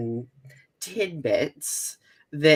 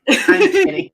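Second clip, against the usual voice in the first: playful shriek, much higher and louder; loud high squeal; 9 dB louder than usual. Real, not acted. Guest blurting out a joke about eating pasties, squealing. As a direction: yelp the joke's reveal.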